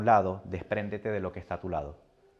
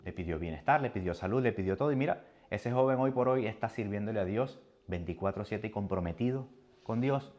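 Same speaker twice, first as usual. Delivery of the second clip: ordinary talk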